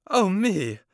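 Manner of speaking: high, loud shocked cry; queen aghast at the stabbing; horrified exclamation. Acted. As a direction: gasp it out in horror. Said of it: "exclaimed the queen".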